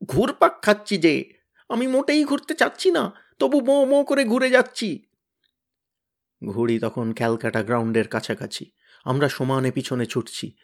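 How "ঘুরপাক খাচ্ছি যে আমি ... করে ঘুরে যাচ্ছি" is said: high, frightened whine; whining. Acted. Tenida spinning in the air complains, whining. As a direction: whimper while spinning. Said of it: "খেয়েই আবার কাউঁ কাউঁ করে বললে".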